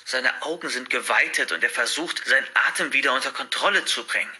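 ordinary delivery

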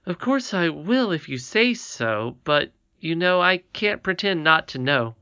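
put-on high, eager child's voice full of wonder; a delighted little girl admiring a crown; little princess Irene. A narrator's reading. Irene marvelling to her grandmother, in a high childlike voice.

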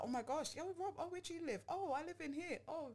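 high, soft, bubbly small-talk voice; code-switching chatter. Retold or imitated. To friend, mocking code-switched posh small talk, high and airy. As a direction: go way up and breezy, mocking forced friendliness. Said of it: "to be like".

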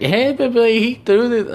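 mock her with a high simpering tattle; high nasal-free parody of a tattling coworker; whiny coworker. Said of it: "came up to me and was like".

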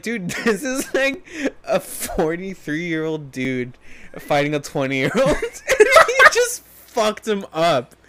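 slow, incredulous words through giggles; laughing disbelief. Real, not acted. Telling friends about a lopsided fight, laughing in disbelief.

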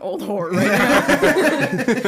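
slow, laughing protest. Real, not acted. Protesting to friends between laughs, slow and laughing. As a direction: drag it out while giggling.

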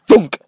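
loud, sudden vocal impact; imitated thunk. Reader voicing the blow by the gun, imitated thunk. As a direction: punch the sound word out hard.

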